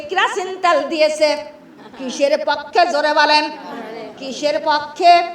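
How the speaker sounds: normal talking voice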